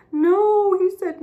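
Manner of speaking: put-on high, loud wailing child's voice; a small boy wailing that nobody plays with him; child crying. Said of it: "he was crying and he said".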